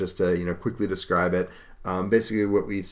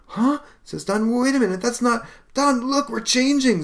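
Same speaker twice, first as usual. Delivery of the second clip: much higher, loud startled voice; alarmed surprise; 5 dB louder than usual. Acted. Brothers transforming, alarmed surprise. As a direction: gasp it out as you change.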